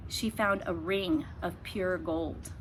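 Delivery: ordinary delivery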